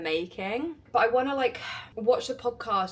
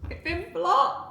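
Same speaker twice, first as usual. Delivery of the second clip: high, rising cry of disbelief; real startled dismay; 8 semitones higher, 3 dB louder, slower than usual. Real, not acted. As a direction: say it higher, as if you can't believe it. Website blocks her mid reveal.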